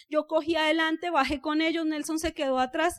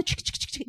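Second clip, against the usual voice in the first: clicking noise; 8 dB quieter than usual. Retold or imitated. Mimicking negative thoughts ticking.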